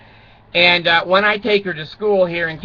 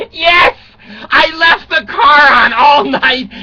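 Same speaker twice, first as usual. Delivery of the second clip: higher, louder, slow wailing yes; mock wailing confession; 8 semitones higher, 7 dB louder, slower than usual. Dad to daughter, hammed-up wailing admission. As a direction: hams up owning the mistake, half-crying.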